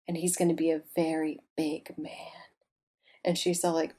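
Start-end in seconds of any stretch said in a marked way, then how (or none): 0.1-2.1 whispered mock prophecy, much softer; psychic's whisper. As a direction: hush it like a secret fortune.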